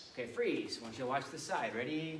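ordinary delivery

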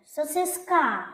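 "Сосиска" wrongly stressed at the end, сосис-КА; stress on last syllable. Shown as a demonstration. Teacher tests the last sound as main, wrongly stressed "сосисКА". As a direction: slowly push the final А of "Сосиска". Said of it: "А буде головним?".

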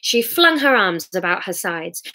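plain reading voice

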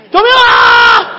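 loud, laughing cry for help; mock shout of 救命啊. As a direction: yell for help, half joking. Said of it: "大声地喊救命啊".

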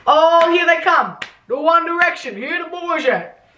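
high, drawn-out hammed-up announcement; mock fanfare. Writer mocking boy band's entrance, high hammed-up announcing. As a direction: herald them with sarcastic, sing-out excitement.

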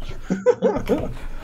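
high unvoiced laugh; breathy victory laugh. Real, not acted. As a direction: laugh with relief. Laugh after winning.

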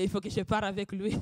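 normal talking voice

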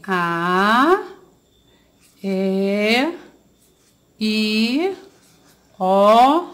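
each vowel "A", "E", "I", "O" sung out long and clear; teacher spelling out vowels for kids; vowels drawn out. Shown as a demonstration. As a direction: say "A", "E", "I", "O" slowly and clearly, one at a time. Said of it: "E quais são as vogais?".

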